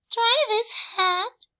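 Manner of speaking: very high offering cat; Cat offers Horse a yarn hat; Cat's voice. A narrator's reading. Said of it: "Cat told Horse".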